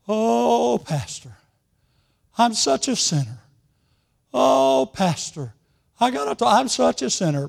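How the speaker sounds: hammed-up weepy lament, pitched high; mock crying. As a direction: imitate a tearful widow confessing. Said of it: "And she was crying".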